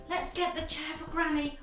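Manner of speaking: plain reading voice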